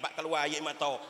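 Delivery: ordinary delivery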